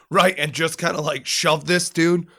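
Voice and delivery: normal talking voice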